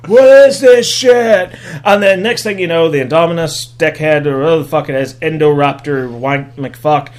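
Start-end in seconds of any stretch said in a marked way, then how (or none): 0.0-1.5 a baffled hunter losing it; hunter's confusion. Retold or imitated. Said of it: "the hunter guy's like".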